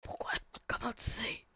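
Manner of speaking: hushed whisper. Pretend. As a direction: keep it all a whisper. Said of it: "Pourquoi je chuchote?".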